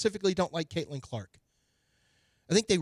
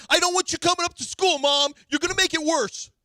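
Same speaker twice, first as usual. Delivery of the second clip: put-on high, pleading teenager voice; whiny kid voice; 13 semitones higher, 5 dB louder than usual. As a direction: beg like an embarrassed kid.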